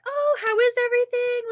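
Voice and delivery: very high waitress check-in voice; imitated gushing waitress; sweet server. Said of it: "so sweet".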